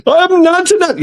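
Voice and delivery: high squeaky mock voice, half laughing; a high falsetto parody of someone insisting; mock whiny denial. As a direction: squeak it out like a silly cartoon protest. Mocking a caller's claim.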